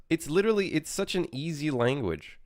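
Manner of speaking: exasperated. Real, not acted.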